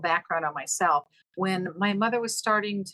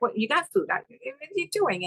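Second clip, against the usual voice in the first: much higher, baffled exasperation; can't believe what she's asking; exasperated disbelief; 9 semitones higher than usual. Retold or imitated. Daughter to mother asking for food, exasperated. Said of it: "It's like".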